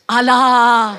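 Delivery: very loud, long drawn-out cry; mock invocation. Comedian acts out her mistranslated special, mock religious invocation. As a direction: call it out long, as if invoking God.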